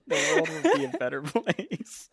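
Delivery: high, giggling punchline; cracking up while finishing the joke; laughing through it. Real, not acted. Co-host finishing a dark joke, laughing. Joking moral of the story.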